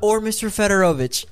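a flight attendant offering the formal surname; polite stewardess voice. Retold or imitated. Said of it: "she goes".

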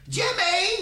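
very high mock mom holler; mother calling out. Victim's mother heard over the phone, calling out. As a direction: call the name high like a woken mother.

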